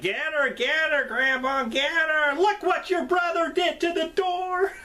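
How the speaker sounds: put-on high, far-off shrieking old man voice urging Grandma on; cackling old cook. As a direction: squeal it out like a giddy old hillbilly cook. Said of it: "the cook is sitting there like".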